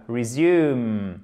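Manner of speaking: "Resume" with the weight on SUME; "Resume", second syllable drawn out and falling; stress on second syllable. Shown as a demonstration. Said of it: "Accent tonique sur la deuxième syllabe".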